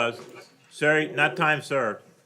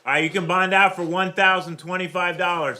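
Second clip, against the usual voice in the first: raised, projected voice; speaking up; 4 semitones higher, 5 dB louder than usual. Real, not acted. Judge gives bond to a man out of hearing, speaking up. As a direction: pitch it up and louder so it carries. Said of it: "Can you speak up a little bit?".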